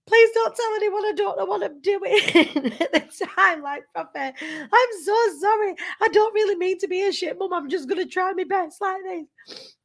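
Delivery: high, pleading, close to tears; retold tearful plea. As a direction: beg the baby, half-sobbing in memory. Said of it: "I remember crying at her, saying".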